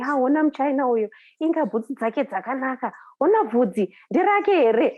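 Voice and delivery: a hint of amazed children marvelling in Shona; excited kids' chatter. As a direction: play the curious kids gawking and whispering about her. Kids chattering in Shona about her.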